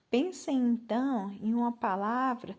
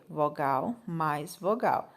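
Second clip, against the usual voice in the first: slow dictation; 5 semitones lower, slower than usual. Shown as a demonstration. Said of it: "teacher explains legend slowly".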